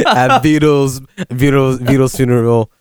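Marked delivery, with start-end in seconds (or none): none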